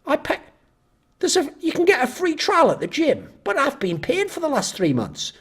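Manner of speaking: a mock child's whine about paying; whiny gym customer. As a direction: sulk like a child who feels cheated.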